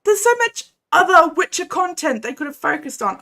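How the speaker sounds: worked-up ranting; fuming rant. Real, not acted. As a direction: vent the fury at Netflix. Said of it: "Oh, I'm absolutely fuming".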